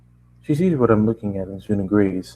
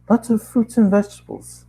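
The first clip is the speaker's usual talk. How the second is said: Bob, high voice; 11 semitones higher than usual. Acted. Bob reporting orders.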